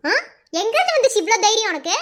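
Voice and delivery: normal talking voice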